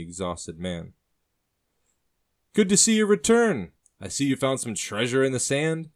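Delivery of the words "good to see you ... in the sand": much higher and louder, tart greeting. Acted. Brother sneers at the sister's new companion, tart and loud. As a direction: greet her with a biting edge. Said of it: "the man says tartly".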